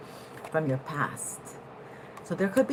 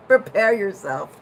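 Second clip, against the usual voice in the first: announcer voice; 3 semitones higher, 8 dB louder than usual. Pretend. Mock pilot announcement.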